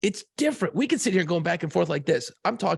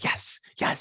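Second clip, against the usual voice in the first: breathless whispered celebration; whispered yes; 4 dB quieter than usual. Retold or imitated. Coach alone in a hallway after beating the Russians, whispering. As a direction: keep the joy to a hushed whisper. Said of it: "he's just like".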